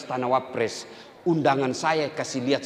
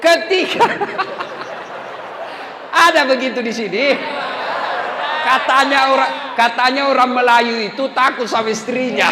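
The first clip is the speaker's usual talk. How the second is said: higher, louder joking sermon; lively preaching; 12 semitones higher, 8 dB louder than usual. Acted. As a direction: punch the jokes to the crowd.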